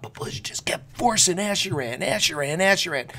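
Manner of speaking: hushed whisper, mock-ominous. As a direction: breathe the name like a secret chant. Bush forcing Acheran on players.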